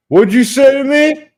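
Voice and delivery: high, loud, drawn-out challenge; confrontational young man. Retold or imitated. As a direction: imitate a guy calling you out. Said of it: "One of them goes".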